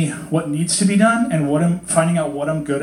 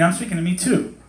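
ordinary delivery

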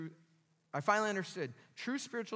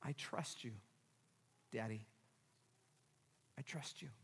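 very soft, low prayer; hushed words to a father, with pauses; trusting surrender; 6 semitones lower, 12 dB quieter than usual. Acted. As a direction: entrust yourself, barely audible.

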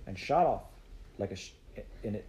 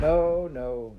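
put-on higher, louder reassuring old woman; fondly dismissive granny voice; 5 semitones higher, 7 dB louder than usual. A narrator's reading. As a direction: brush the worry away, drawn out. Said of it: "stroking her fondly".

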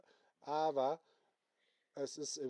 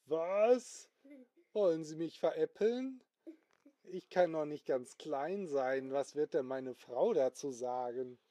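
acted indignation; 3 semitones higher, 6 dB louder than usual. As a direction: protest like an affronted car owner. Owner reacts to shrinking offer.

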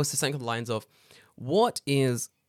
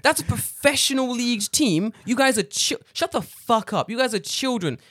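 high, loud exasperated telling-off; mock rant; 8 semitones higher, 7 dB louder than usual. Lecturing the teacher in his head, loud high scolding. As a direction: scold them like naughty children.

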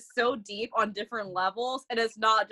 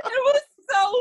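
words squeezed out through high laughter; can barely get it out for laughing; laughing hard; 4 dB louder than usual. Real, not acted. Telling friends about a funny clip, laughing through the words.

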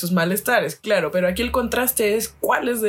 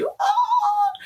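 retold pained yelp. Retold pain as mom pulls scab.

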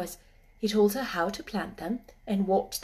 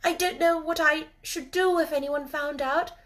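high-pitched, worried confiding; tense girlish voice, pitched high; anxious girl; 7 semitones higher than usual. A narrator's reading. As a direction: confess it as a girl fearing discovery. Mary anxiously confides her secret.

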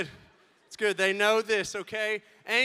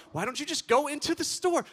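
higher, mocking replay of her suggestion; mocking echo; 6 semitones higher, 3 dB louder than usual. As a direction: parrot the wife's words. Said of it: "She's like".

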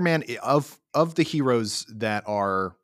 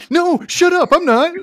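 squealed protest, much higher and louder; indignant shriek; 12 dB louder than usual. Real, not acted. Accused of rooting for the Empire, shrieking denial. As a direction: shriek it, half laughing, in mock outrage.

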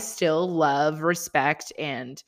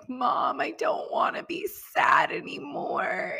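put-on higher, small breathy child's whine; imitating a sad toddler; 6 semitones higher than usual. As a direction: play the upset little boy pleading with mom. Toddler quoted complaining he's sad.

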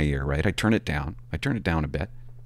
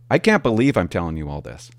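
normal talking voice

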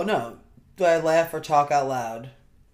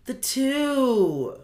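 sleep talking; 7 semitones higher, much slower than usual. Retold or imitated. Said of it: "And I was like".

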